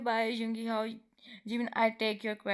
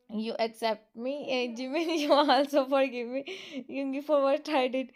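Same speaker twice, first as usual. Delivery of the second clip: giggling through the words, louder and higher; laughing; 3 semitones higher, 5 dB louder than usual. Real, not acted. Reader still giggling as Jimin asks forgiveness, laughing. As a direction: keep laughing while reading on.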